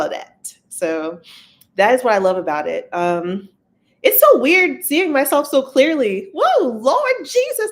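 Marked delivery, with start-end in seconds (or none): 4.0-7.7 high, loud burst of surprise; mock alarm. Real, not acted. Streamer startled by seeing herself so clearly, playfully alarmed. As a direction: exclaim in a high voice, stretching out the whoa.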